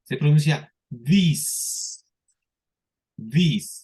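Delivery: This with a long hissed s like a snake; teacher hisses This like a serpent; hissing s. Shown as a demonstration. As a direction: stretch the s in This. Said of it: "así como serpiente".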